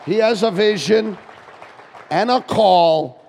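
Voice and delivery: loud, drawn-out preacher's call; preaching shout. Real, not acted. Pastor proclaiming the calling to the congregation, loud and slow. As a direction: ring it out to the rafters. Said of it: "preaching crescendo".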